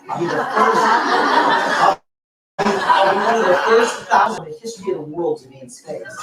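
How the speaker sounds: giddy with excitement at the dream; real delighted laughter while speaking; excited laughing. Real, not acted. Thrilled about becoming astronaut.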